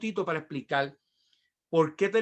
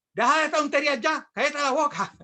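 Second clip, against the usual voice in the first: high, exasperated telling-off; sharp mock reprimand; scolding father; 8 semitones higher, 3 dB louder than usual. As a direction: imitate a fed-up dad shutting down the nonsense. Father scolding crying son.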